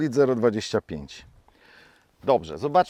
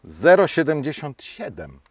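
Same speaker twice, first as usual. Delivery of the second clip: ordinary delivery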